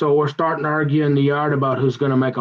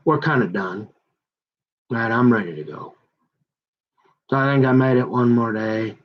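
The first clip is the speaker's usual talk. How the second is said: ordinary delivery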